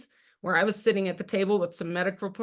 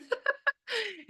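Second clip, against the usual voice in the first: a high real laugh that falls away; high burst of laughter; 5 dB quieter than usual. Real, not acted. Laughs after own question.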